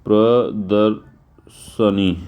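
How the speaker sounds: प्रदर्शनी spelled out very slowly, syllable by syllable; slow demonstration. Shown as a demonstration. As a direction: drag out ये होता है प्रदर्शनी like dictating to students. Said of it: "इस तरह से नहीं लिखा जाता". (धीमे-धीमे समझाते हुए)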